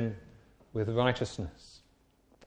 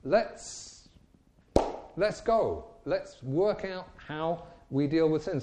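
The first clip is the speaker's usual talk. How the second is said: mocking eager Pharisees; 5 semitones higher, slower than usual. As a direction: ham up smug eagerness to test. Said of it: "They say".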